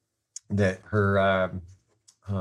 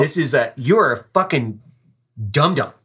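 loud, higher-pitched mock insult; dead-serious scolding; 5 semitones higher, 6 dB louder than usual. Chess master insulting his weak-playing driver, dead-serious scolding. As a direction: imitate an exasperated passenger berating the driver. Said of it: "He was serious".

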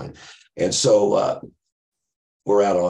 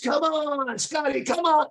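much higher, crazed coaxing voice; Goodfellas-style Ray; slower than usual. Retold or imitated. Friend urges him on during the test, high manic coaxing. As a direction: coax him like a manic movie gangster. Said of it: "there's like Goodfellas".

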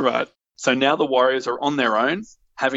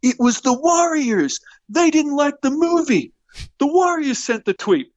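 Luther's shrill accusation; 14 semitones higher than usual. Acted. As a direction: squeal it out high. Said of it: "Luther pops his head and goes".

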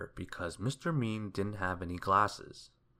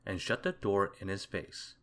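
ordinary delivery

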